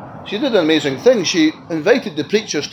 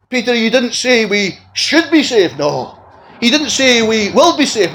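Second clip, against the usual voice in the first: high, louder, stressing "should" and "will"; emphatic preaching; 8 semitones higher, 5 dB louder than usual. Real, not acted. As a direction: hammer home the wrong words "should" and "will". Preacher stressing must over should.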